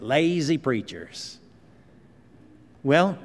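put-on slow, drawn-out lazy preachers in an old Southern preacher's accent; J. Vernon McGee's accent. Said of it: "Like the way he says it in that accent".

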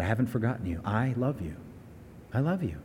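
God softly reassuring the discouraged listener; tender reassurance. Acted. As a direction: say it softly, like comforting a discouraged friend.

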